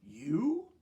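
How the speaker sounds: shocked little squeak of disbelief; surprised. Acted. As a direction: caught off guard, nearly falling in. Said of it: "so surprised".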